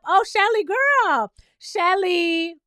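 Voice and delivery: high, loud wail of disappointment; exasperated dismay. Acted. Host pleads with Shelly through the screen, exasperated.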